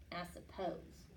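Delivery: soft, grudging mutter; sulky mutter. Acted. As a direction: huff it out under your breath. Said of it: "muttered Wilf with a huff".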